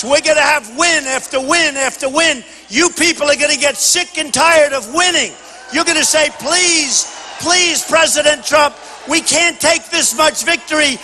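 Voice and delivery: high, emphatic, full-force rally finale; rousing rally speech. Real, not acted. Candidate promises the rally crowd endless wins, rousing and high-pitched. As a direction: drive each promise home to a cheering crowd.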